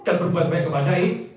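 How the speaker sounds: preacher voicing a husband answering his wife; firm husband reply.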